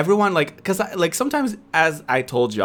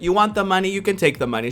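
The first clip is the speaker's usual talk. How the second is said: raised voice; 7 semitones higher, faster than usual. Retold or imitated. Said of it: "I raised my voice".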